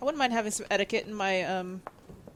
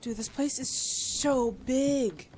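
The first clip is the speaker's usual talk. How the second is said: slow awed drawl; 3 semitones higher, much slower than usual. Real, not acted. Second take, drawn out in awe.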